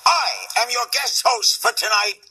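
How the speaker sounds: loud, high announcer voice; Larry King. Pretend. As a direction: boom it out like a talk host. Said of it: "Larry King, everybody".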